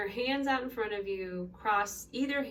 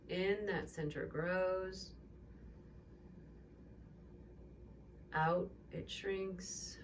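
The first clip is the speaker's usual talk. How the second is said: calm yoga teacher talking a class through breaths; guided breathing; 4 dB quieter than usual. Acted.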